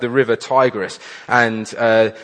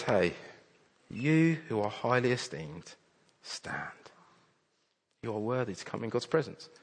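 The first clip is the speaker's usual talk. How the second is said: much softer, gentle and intimate; almost breathe it to them, full of comfort; tender reassurance; 12 dB quieter than usual. Acted. Preacher voicing God to a weary listener, soft and tender. God's words to the congregation, softly.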